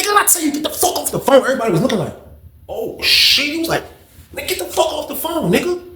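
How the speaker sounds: retold order in a high, strained voice; high-pitched mock order. Roommate orders man off phone.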